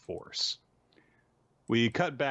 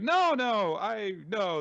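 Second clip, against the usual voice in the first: much higher, louder stammering protest; flustered denial; 12 semitones higher, 5 dB louder, much slower than usual. Retold or imitated. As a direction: deny it in a panic. Toki flustered denying high birth.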